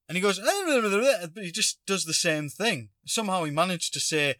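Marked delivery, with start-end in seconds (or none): 0.4-1.3 high-pitched nonsense jabbering; mock rambling jabber. Stranger jabbering at the delivery driver, mock high ramble. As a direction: imitate an unintelligible rambler. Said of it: "he just starts rambling again".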